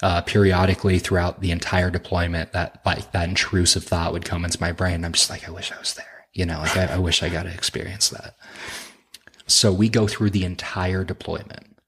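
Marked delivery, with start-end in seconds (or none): 5.5-6.3 soft longing whisper, much softer; a quiet, wistful aside to himself. Retold or imitated. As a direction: breathe it out like a private wish. Wistful intrusive thought.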